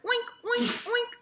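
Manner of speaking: high, silly pig grunts; mock pig oinks. A narrator's reading. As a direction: oink like a playful boy. Boy imitating a pig.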